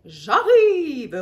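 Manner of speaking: loud roar of arrival. A narrator's reading. Mum roars to rescue her son. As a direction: bellow it like a charging mother. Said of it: "rugit maman".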